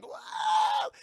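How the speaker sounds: very high, loud "whoa"; cartoonish falling yelp. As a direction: whoop as if yanked away. Imitates being dragged away.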